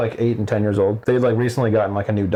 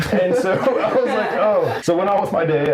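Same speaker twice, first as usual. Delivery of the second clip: laughing; 10 semitones higher than usual. Real, not acted. Laughing retelling.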